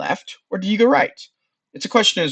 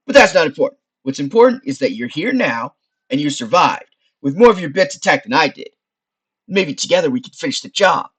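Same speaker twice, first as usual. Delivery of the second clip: loud, higher wise-guy skull; Frank; 3 semitones higher, 8 dB louder than usual. Acted. Skull explaining the strange place, loud wise-guy voice. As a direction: explain it with pushy, chummy energy.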